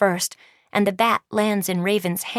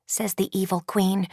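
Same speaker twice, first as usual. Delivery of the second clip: usual speaking voice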